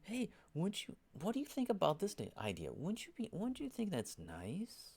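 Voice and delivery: very high, sugary wheedling mimicry; mock passive-aggressive. Discord sower going around suggesting things, hammed-up high sugary voice. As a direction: ham up a coy, fishing-for-agreement voice. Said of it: "then they're going around".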